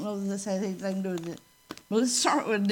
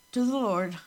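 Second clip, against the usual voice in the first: ordinary delivery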